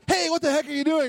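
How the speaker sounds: mock annoyed surprise, fast and high; mock startled outburst. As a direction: play a startled host, half-joking. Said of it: "Not like".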